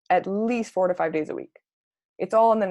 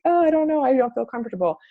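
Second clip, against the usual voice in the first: higher-pitched mock hesitancy; hesitant; 5 semitones higher, 3 dB louder than usual. Coach voicing a reluctant inviter, mock hesitant. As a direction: imitate someone uncomfortable, high and louder. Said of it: "another one that isn't as like".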